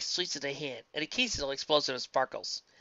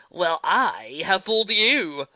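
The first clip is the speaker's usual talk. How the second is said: loud, slow gloating; slow triumphant crowing; 6 semitones higher, 10 dB louder, much slower than usual. Acted. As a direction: gloat over the trick.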